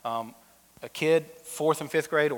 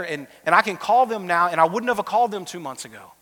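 rising preaching push; 3 semitones higher, 4 dB louder than usual. Real, not acted. Closing appeal to the congregation.

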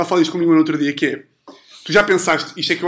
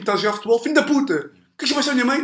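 mock football player; 7 semitones higher, faster than usual.

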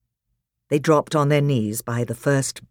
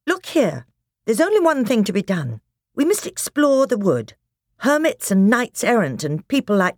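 put-on eager, raised boyish voice; boy's voice; 6 semitones higher, 3 dB louder than usual. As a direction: urge them on like a keen boy. Edmund urges exploring.

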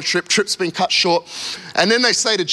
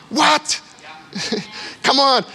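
higher, incredulous 'what?'; mock disbelief; 4 semitones higher, slower than usual. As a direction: react as if you can't believe it.

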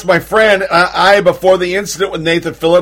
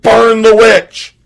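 mock scream; 3 semitones higher, 4 dB louder, slower than usual. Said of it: "and screamed".

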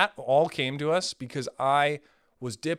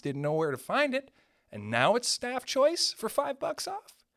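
higher, incredulous rant about the wine sale; mock outrage; 7 semitones higher than usual. Wine buyer reacting to the sale, higher incredulous rant. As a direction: sound stunned at the staff pick. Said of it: "acting the wine buyer's shock".

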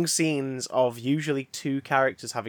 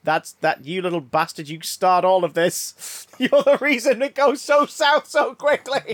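mock scolding; 4 semitones higher, 5 dB louder than usual. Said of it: "you're like".

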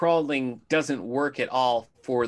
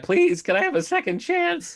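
high-pitched, louder plea; mock pleading; 5 dB louder than usual. Flustered auditioner asks Roy for another try, mock pleading. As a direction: beg in a hammed-up imitation. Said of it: "And he's like".

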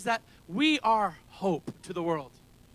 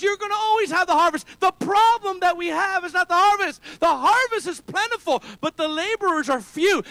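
preaching loud; 8 semitones higher, 6 dB louder than usual. Retold or imitated. Quoting Jesus, preaching high.

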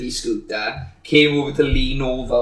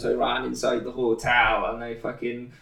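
ordinary delivery

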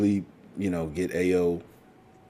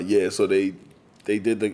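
normal talking voice

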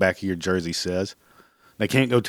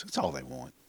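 much softer, half under the breath; a near-silent mock sigh of a line; quiet aside; 15 dB quieter than usual. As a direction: drop to a muttered aside. Mocking the kids' attention-seeking.

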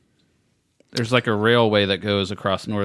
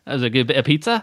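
put-on British accent; 4 semitones higher, faster than usual. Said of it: "going to town on these British accents".

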